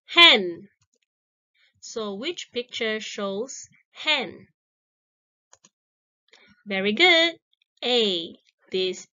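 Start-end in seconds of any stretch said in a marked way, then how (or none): 0.1-0.7 a loud, clear "Hand."; loud model word. Shown as a demonstration. Teacher calls out new word to the class, loud. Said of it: "read your answer loudly". 6.6-7.3 high, loud "very good"; bright praise. Real, not acted. Teacher praises a child's correct answer, excited.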